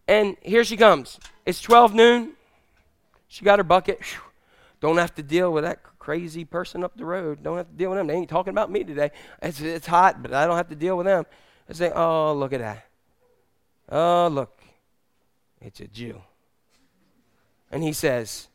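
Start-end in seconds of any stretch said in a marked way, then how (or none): 4.8-11.3 soft, low grumble under the breath; muttering to herself. Acted. Woman muttering to herself at well. 11.9-16.2 low, soft aside trailing away; muttered dismay. Acted. Woman spots the man, grumbles.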